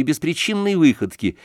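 ordinary delivery

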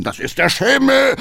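high, loud call back. Acted. Cabman calling back to the councillor. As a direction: shout over the noise. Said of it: "rief Hackendahl".

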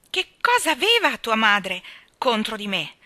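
loud, indignant outcry; loud wounded demand; shrieked. A narrator's reading. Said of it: "strillò Janet".

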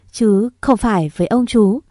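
usual speaking voice

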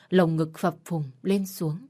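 usual speaking voice

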